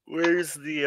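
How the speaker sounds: usual speaking voice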